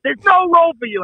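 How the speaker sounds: high, loud mock shout; imitating an exasperated producer; exasperated refusal. Acted refusal in retold argument.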